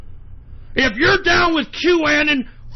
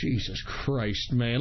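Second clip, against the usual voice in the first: weary, quiet; 13 semitones lower, 9 dB quieter, slower than usual. Real, not acted. Exhausted sigh after rant.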